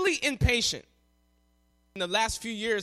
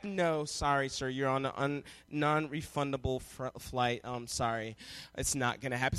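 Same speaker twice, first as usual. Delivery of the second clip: low, soft, halting refusal; counter lady; 11 semitones lower, 9 dB quieter than usual. Retold or imitated. Counter agent refuses passenger a refund, coolly apologetic. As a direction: play the agent calmly shutting a customer down. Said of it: "they're just like".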